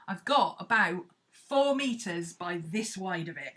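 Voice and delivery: ordinary delivery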